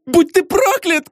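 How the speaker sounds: put-on high, furious young woman cursing; high angry cry; cursing shout. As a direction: hurl the curse at him. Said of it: "крикнула Регина".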